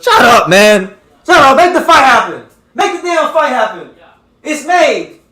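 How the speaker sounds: loud, high exasperated yelling; angry shout. Real, not acted. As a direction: shout them down, fed up and laughing. Yelling at boxing negotiators.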